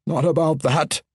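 dwarf lord cutting her off; snapped. A narrator's reading. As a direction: snap it back at her. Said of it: "Bruner snapped".

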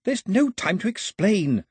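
high, slowed insistent hiss; tense, high-pitched insistence, drawn out; hissed urgency. A narrator's reading. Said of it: "hissed Daphne".

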